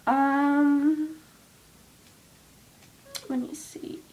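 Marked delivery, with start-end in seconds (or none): 3.3-4.0 whispered to herself while choosing. Real, not acted. Vlogger picking a song, whispering to herself. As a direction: breathe it out, thinking. Thinking aloud before a song.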